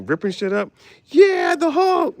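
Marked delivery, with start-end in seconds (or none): 1.1-2.2 high, loud, slow cheering; mock hype cheer. Hyping the Hulk saving the movie, high loud cheer.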